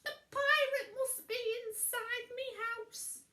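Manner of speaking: high, loud alarmed witch voice; high panicky witch cry; Winnie voice. A narrator's reading.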